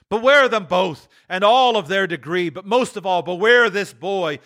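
high, solemn warning voice; solemn spirit. Acted. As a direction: warn with mounting force. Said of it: "cried the spirit".

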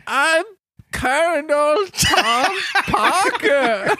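very high, slow echoing squeak; parroting recruit voice. Acted. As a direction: parrot the name back dimly.

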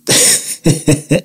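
short airy real laugh; quick disbelieving chuckle under the breath; breathy laugh. Real, not acted. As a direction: let a soft unvoiced laugh slip out. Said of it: "I'm laughing".

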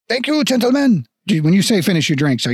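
high, grateful mock-French voice; hammy French thanks; bad French accent. Dwarf shopkeeper thanks departing adventurers, bad French accent. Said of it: "very shitty French accent".

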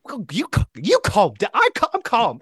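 high indignant mock anger; mock angry retort. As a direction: protest like a furious patient. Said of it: "really mad".

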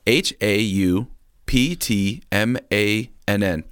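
H A U P T M A N N spelled slowly, letter by letter; spelling it out. Shown as a demonstration. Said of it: "I'm gonna spell it for you".

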